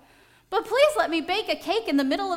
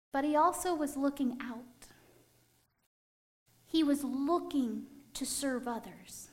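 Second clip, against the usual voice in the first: hushed; 6 dB quieter than usual. Acted. Reflective hushed aside in sermon.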